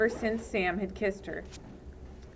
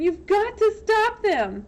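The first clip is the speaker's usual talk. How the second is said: high, loud insistence; urgent; 12 semitones higher, 6 dB louder than usual. Acted. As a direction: demand action now. Urging sheriff.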